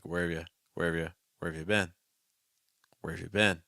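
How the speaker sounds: "Where have you" quick, merged into where-vya; common reduction. Shown as a demonstration. Teacher drills students, "have you" weakly reduced. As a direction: slur "have you" into vya, stress "been". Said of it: "it's very common to get that reduction".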